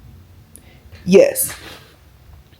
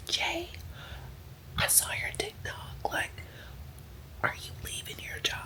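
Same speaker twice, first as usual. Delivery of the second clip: hushed secretive coworker whisper; whispered quote; 10 semitones higher, 25 dB quieter than usual. Retold or imitated. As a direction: lean in and whisper like it's a secret. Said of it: "Why are we whispering?".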